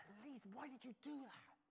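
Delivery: put-on high, stern telling-off of a child; mock scolding father; dad telling off his daughter after finding her. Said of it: "I said".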